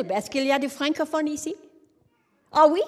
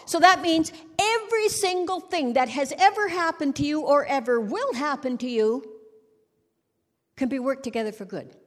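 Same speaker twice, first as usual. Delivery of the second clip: ordinary talk, nothing marked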